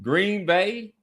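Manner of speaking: normal talking voice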